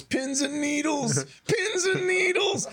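high, slow, drawn-out pins and needles; movie quote. Retold or imitated. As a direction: stretch it out in awe. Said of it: "and he goes".

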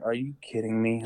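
a soft, light, hushed exclamation of disbelief; said really lightly. Retold or imitated. Dad in the blind seeing the giant buck, really light and soft. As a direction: barely breathe out the amazement. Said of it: "Like, really lightly".

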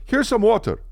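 high-pitched, kindly helper voice; imitated nice guy. Kind stranger hands over water, imitated high helper voice. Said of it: "The guy's so nice".

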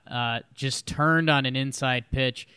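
usual speaking voice

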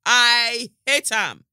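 loud, slow and high; mock vehement. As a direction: stretch out the declaration with force.

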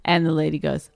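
ordinary talk, nothing marked

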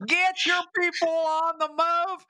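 high, slow sustained command; imitated colonel. Private pranking Captain Sobel as the colonel, high drawn-out order. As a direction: call the order out, stretching it. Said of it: "perfect imitation of the colonel".

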